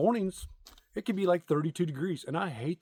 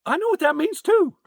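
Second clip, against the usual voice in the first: much higher and louder, a mock outraged cry; exasperated; 10 dB louder than usual. Real, not acted. Comic telling his audience he got the insult, loud exasperated outburst. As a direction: throw it out in indignation. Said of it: "knowing punchline outburst".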